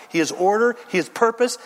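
normal talking voice

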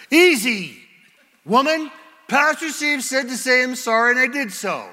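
loud, high, hammed-up indignation; defensive husband. Husband defending his forced apology to his wife, indignant. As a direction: push back loudly, as a put-upon husband.

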